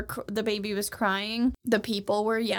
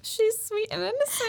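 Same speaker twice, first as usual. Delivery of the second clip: high pleading; 9 semitones higher than usual. Acted. Defending the choice, mock pleading.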